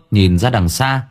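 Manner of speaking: ordinary delivery, nothing marked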